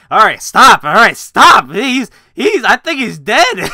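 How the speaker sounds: loud frantic mock shout, high-pitched; panicked yell. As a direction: yell it like a scared onlooker begging him to stop. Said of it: "Catwoman looking in the background like".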